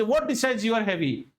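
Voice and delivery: ordinary talk, nothing marked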